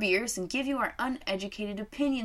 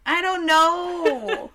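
laughing; 9 semitones higher, 8 dB louder, much slower than usual. Real, not acted. Laughing reply about Crocs.

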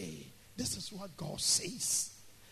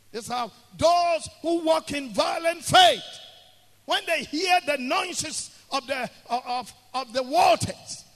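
loud, raised-pitch preacher's delivery; full-force preaching; 7 semitones higher, 13 dB louder than usual. Real, not acted. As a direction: drive the faith home, shouting the key words.

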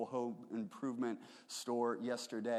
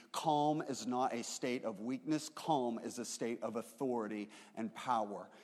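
usual speaking voice

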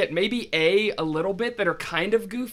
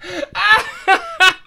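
laughing hard; 12 semitones higher, 7 dB louder, slower than usual. Real, not acted. Helpless laughter at the prank.

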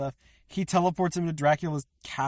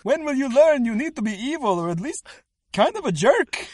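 high, loud pleading father voice; hammed-up parental despair; scolding villain dad; 9 semitones higher, 5 dB louder than usual. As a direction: whine loudly at your disappointing son. Second speaker acts scolding Dracula.

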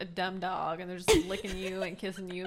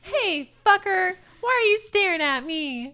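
mock loud yell; 11 semitones higher, 9 dB louder than usual. Playful mock insult, high and loud.

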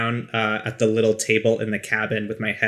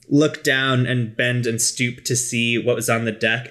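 ordinary delivery

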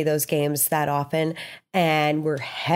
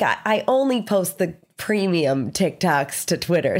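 usual speaking voice

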